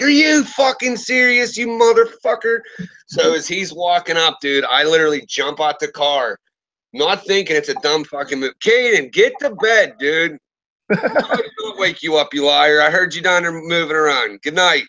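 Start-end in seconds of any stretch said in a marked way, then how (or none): 0.0-2.6 mock outraged. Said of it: "I'm like". 8.6-14.8 raised voice calling off-mic; calling to son. Real, not acted. Father calling to his son to go to bed, raised calling voice.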